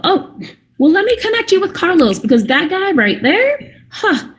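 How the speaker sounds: high-pitched, enthusiastic offer; eager pitch. Acted. Agent offering to connect a recruit with Carlos, eagerly. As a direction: talk up a colleague with acted excitement.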